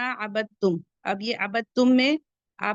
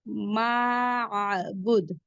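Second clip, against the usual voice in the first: very slow, stretched recitation; drawn-out recitation; much slower than usual. Shown as a demonstration. Teacher models the long vowel for students, very slow. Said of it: "recites the word slowly".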